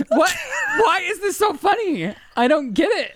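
helpless giggling fit, words breaking up; laughing. Real, not acted.

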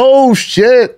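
high, loud amazed outburst; thrilled, high-pitched yelp of disbelief; crunk sideline reaction. Retold or imitated. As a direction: re-enact a hyped-up dad seeing a goal. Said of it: "And I was crunk".

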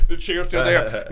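ordinary talk, nothing marked

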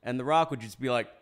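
ordinary talk, nothing marked

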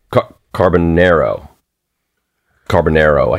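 carbonaro in a Long Island accent, ending in oh. Shown as a demonstration. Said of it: "Long Island accent would talk was carbonaro".

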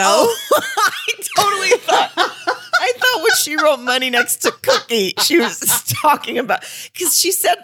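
high-pitched laughter through words; laughing hard. Real, not acted. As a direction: laugh helplessly while talking. Hysterical laughter over misunderstanding.

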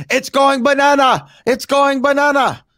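put-on loud, high-pitched French Canadian accent; Pat Patterson. Host quoting a late French Canadian wrestling guy, French Canadian accent. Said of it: "in his French Canadian accent".

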